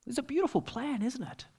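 ordinary delivery

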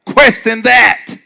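loud rising preacher call and answer; preaching at full force. Acted. Preacher to congregation, loud call and response.

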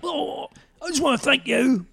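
high, strained mock-British voice; hammed-up, overwrought thanks; Jason Statham. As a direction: plead it in a silly British accent. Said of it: "And then Jason Statham's like".

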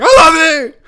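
high, loud fan scream. Retold or imitated. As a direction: yell it up at your idol. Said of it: "so I just screamed down".

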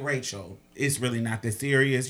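low, soft retold reasoning; respectful, calming. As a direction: talk her down quietly and politely. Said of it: "respectfully calling her her name".

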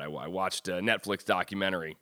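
ordinary delivery

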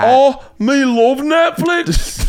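high, slow, botched Scottish woman; female Scottish accent. Pretend. Friend improvising a Scottish chick, badly done female Scottish accent. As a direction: badly fake a Scottish lass. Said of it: "I'll do female Scottish accent".

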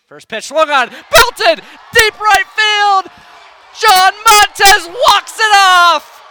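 building to a full-throated shout; shouting home run call. Real, not acted. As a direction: call the walk-off at the top of your lungs. Home run call, walk-off win.

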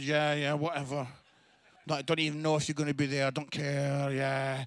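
low, loud, droning show of indifference; mock nonchalant, dismissive monotone; acting not bothered. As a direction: shrug it off with exaggerated casualness. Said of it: "act like you're not bothered".

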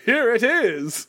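high, drawn-out mock British announcement; British accent. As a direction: stretch it out in a put-on British voice. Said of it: "a British accent".

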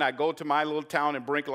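ordinary talk

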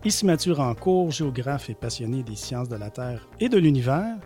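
upbeat radio show intro; public radio host. Acted. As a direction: warm announcer welcome over music. Show intro over music.

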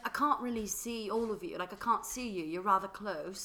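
put-on hammy amateur British accent, slowing at the end; butchered British accent. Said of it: "I'm gonna butcher this accent".

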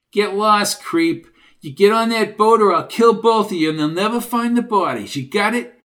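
angry threat. Acted. Junior threatening two strangers at gunpoint, angry. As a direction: drive them off with menace.

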